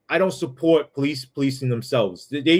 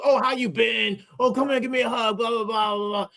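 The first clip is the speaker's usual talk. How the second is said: high mock gushing greeting trailing off; fake friendly guy; 6 semitones higher than usual. Coworker pushes for a hug, high mocking phony voice. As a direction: parody a phony buddy's hug request. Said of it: "Instead, he goes".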